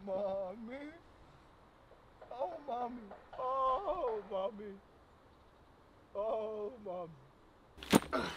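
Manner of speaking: soft, quiet whimpered calls; real quiet whimpering; whimpering for mommy. Real, not acted. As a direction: whimper for your mother, barely voiced. Softly calling mother in distress.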